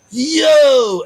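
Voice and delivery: sudden loud high holler. Retold or imitated. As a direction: yell it out to break the silence. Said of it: "hollers out like".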